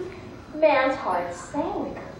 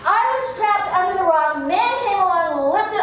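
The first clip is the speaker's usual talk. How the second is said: much higher, louder furious correction; angry snake; 6 semitones higher, 4 dB louder than usual. Acted. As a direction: shout the correction at the fool. Snake angrily correcting Fox.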